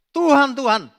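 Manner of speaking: high, loud imitated cry of Tuhan, Tuhan; hammed-up calling Lord, Lord; mock pleading cry. Quoting those crying out to God.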